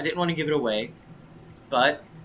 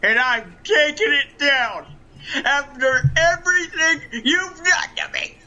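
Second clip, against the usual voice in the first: loud, brash wrestler promo voice; boastful wrestling villain, shouting; Ric Flair impression; 9 semitones higher, 5 dB louder, slower than usual. Plays the Nature Boy, Ric Flair.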